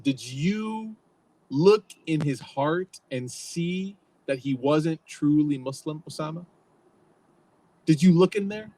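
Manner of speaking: a prophet confronting Osama with a grave question; powerful rhetorical question. Retold or imitated. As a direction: press the question home, word by word. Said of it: "asking him powerfully this rhetorical question".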